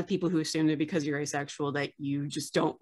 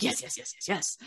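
whispered mock insistence; 3 semitones higher, 7 dB quieter than usual. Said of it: "and you're like".

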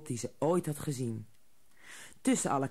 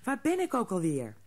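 sleepy little hare; 7 semitones higher than usual. A narrator's reading. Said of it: "Nog een beetje suf".

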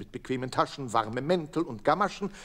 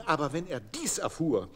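usual speaking voice

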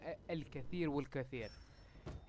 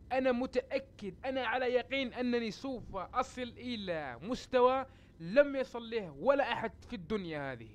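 high-pitched, insistent declaration; raised, emphatic vow; emphatic conviction; 7 semitones higher than usual. Real, not acted. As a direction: proclaim it with total certainty. Repeats his boast with emphatic conviction.

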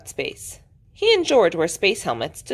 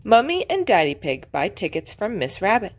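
ordinary delivery

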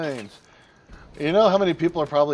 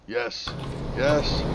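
usual speaking voice

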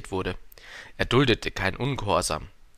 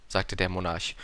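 usual speaking voice

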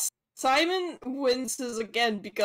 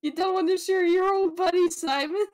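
higher, teasing wheedle; playfully pushy, raised pitch; needling taunt; 8 semitones higher, 4 dB louder than usual. Acted. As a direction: goad them like a pestering old pal. Simon character taunts another character.

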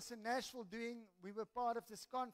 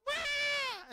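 very high, loud mock wail; imitated scream; 12 dB louder than usual. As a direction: let out a sudden piercing imitation scream. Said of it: "she just screamed".

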